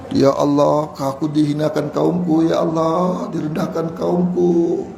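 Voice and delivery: low, hushed supplication to God; humble prayer. Acted. Prophet begging Allah before mocking people, hushed prayer. Nabi Soleh prays to Allah.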